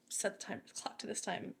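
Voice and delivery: a stern boss giving orders very quietly; cold quiet order. Retold or imitated. Chief's cold order, quoted.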